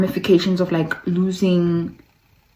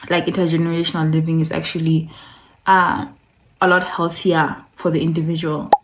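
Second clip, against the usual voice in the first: ordinary talk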